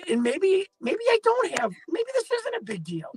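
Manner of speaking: high, light, disbelieving hope; giddy hope. Retold or imitated. Journalist hoping the case is easier, rising giddy. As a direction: float up as relief sinks in. Retold relieved inner thought.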